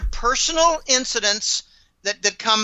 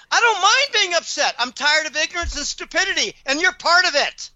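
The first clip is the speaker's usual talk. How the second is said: fierce retort; 9 semitones higher than usual. Retold or imitated. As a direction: snap back with pride in being upset. Retold angry retort.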